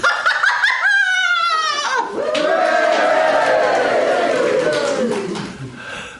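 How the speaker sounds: pirate woman laughing at the sight of the sea; triumphant cheering laugh. Acted. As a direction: cheer and cackle in victory. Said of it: "the pirate woman triumphantly cheered".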